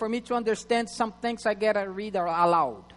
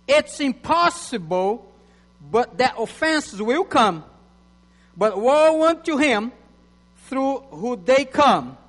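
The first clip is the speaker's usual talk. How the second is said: measured preacher's scripture read; 2 semitones higher, 6 dB louder than usual. Acted. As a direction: proclaim the verses to the church. Reads scripture aloud to church.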